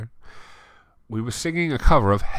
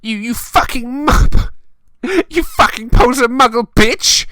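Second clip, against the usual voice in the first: much higher, loud mock shriek; over-the-top screech of betrayal; hammed-up shout; 9 dB louder than usual. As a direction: scream it like a furious goth boyfriend. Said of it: "he shouted".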